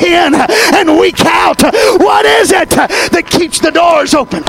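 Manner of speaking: rising into a shouted preacher's cry, slowing at the peak; preaching. Real, not acted. Pastor urging his congregation, building preacher's shout. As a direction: preach it up to a shout, drawing out the last lines.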